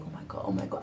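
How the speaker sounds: whispered pleading; terrified whisper. Retold or imitated. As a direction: beg silently under the breath. Hiding, praying mom stays out.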